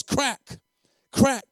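loud, high voiced whip crack; belt crack. A narrator's reading. Preacher narrates the beating, loud sharp crack.

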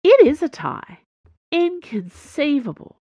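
high, loud burst of disbelief; can't believe the bet is a tie; shocked disbelief. Acted.

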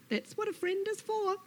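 high-pitched placating lizard; soothing Lizard. Acted. Lizard reassures Snake, placating.

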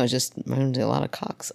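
usual speaking voice

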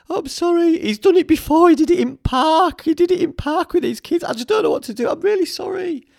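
very high, loud squeaky wail; mock crying apology. Crying dog owner apologising to him, high mock wail. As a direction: imitate a tearful woman in a hammed-up falsetto. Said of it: "and she started crying".

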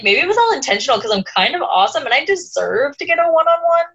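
high, boastful mock whine; smug Harry. Harry boasting to friends, high smug mock whine. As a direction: brag like you earned it. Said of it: "Harry turns around and is like".

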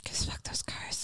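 whispered curse; slow hushed whisper. Real, not acted. Muttered curse.

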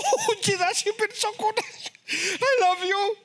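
very high, wailing praise; overcome worshipper. Acted. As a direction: squeal it like someone overcome in church. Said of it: "And this guy comes".

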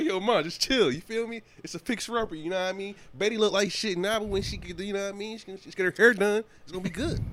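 4 hyping up a beat-up car to mom; 1 pleading defense. Retold or imitated. Retelling his defense of the car to mom.

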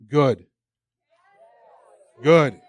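emphatic rebuttal. Real, not acted. Preacher answering the lie, loud and defiant. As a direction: slam the word down.

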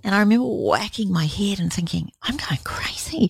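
an unvoiced, high whisper as if confessing. Whispering to a friend about losing her mind, hushed. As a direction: whisper it, reliving the breakdown. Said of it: "whispered retelling".

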